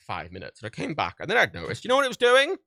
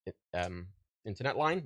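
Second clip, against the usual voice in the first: usual speaking voice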